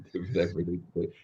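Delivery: quick, soft repeat through a laugh; laughing echo. Real, not acted. Friend laughing as he echoes the early riser's line. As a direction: chuckle the words back at him.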